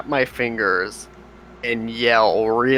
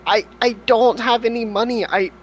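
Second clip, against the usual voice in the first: high, pleading character voice; nervous apology; 9 semitones higher, 5 dB louder than usual. Acted. Adventurer pleading with the angry farmer, high and flustered. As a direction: beg forgiveness in a squeaky panic.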